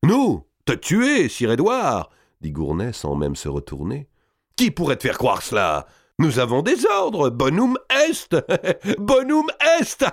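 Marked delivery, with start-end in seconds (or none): 0.0-2.1 very high, put-on disbelief; mock astonishment. A narrator's reading. Gournay answers the frightened king, mock astonishment. Killer feigns innocence to the king. 4.6-10.1 high jeering, breaking into laughter; mocking glee. A narrator's reading. Killers quote their orders to the king, mocking glee.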